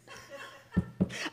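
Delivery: airless mischievous laugh; breathy laugh. Real, not acted. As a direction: let out a sly breathless laugh. Laugh after manipulate joke.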